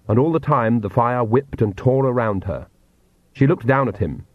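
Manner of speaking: ordinary delivery, nothing marked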